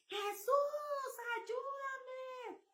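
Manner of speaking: high, pleading shout of a name; desperate cry for help. Retold or imitated. Woman recalling her terror cries out to Jesus, high and pleading. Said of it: "grité a todo pulmón".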